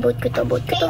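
boat line dropped way low as a joke; mock deep voice. Gamer jokes to viewers in a low mock voice. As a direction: growl it low and silly.

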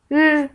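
R as a held trill, "rrr"; letter sound demo. Shown as a demonstration. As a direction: roll R with no following vowel. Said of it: "diye okunur". (R'yi "rrr" diye titret)

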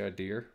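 "I dear" with an added R at the end; idea with an R. Retold or imitated. Streamer teasing a European about an extra R, idea with an R. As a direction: say "I dear" ending on an R sound. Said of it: "There's no R in the word idea".

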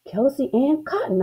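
high, exasperated snap; snapped retort. A narrator's reading. Girl correcting her brother about the culprit, snapped retort. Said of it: "I snapped".

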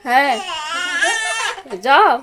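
put-on high, loud sing-song coaxing the baby; loud, lilting baby talk to the infant. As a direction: coo brightly up at the little one. Mother coaxes the baby.

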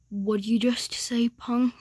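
low, quiet threat; hushed tough-guy menace; menacing challenge. Acted. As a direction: turn on them in a low near-whisper. Menacing reply to the insult.

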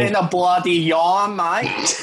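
hammed-up high Australian twang; Aussie accent. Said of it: "that was my Aussie accent".

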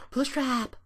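high, loud outburst of the name; sudden startling yell. Pretend. Player blurts a character's name and scares himself, startling yell. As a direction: burst out high enough to scare yourself. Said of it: "Why did I say that like that?".

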